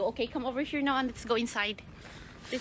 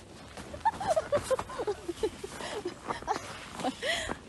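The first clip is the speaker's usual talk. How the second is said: high laughter; 14 semitones higher than usual. Real, not acted. Laughing at Lily.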